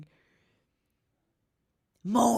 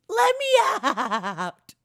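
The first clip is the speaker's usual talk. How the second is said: slow, laughing cry to be let out; playful laughing yell; laughing mock shout; 6 semitones higher, 4 dB louder, slower than usual. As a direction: ham up the prisoner begging through laughter. Pretend jail plea.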